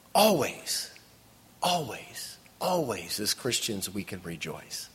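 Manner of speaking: sudden drop to a soft, low voice; hushed repetition. Real, not acted. Preacher drops to hushed repetition.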